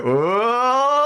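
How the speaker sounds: high cheering whoa into a laugh; rowdy whoop. Acted. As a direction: whoop like lads teasing a friend. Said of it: "the guards go".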